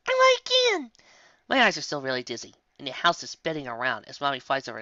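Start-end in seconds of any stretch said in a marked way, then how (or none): 0.1-0.9 put-on loud, high child's whine; whining. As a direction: whine loudly, demanding more. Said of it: "I whined".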